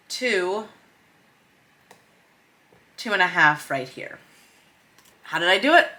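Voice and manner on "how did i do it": normal talking voice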